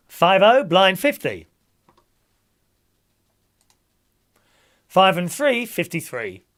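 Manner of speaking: loud, sing-song number calling; bingo caller. Acted. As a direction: call the numbers to the hall. Calls bingo numbers to players.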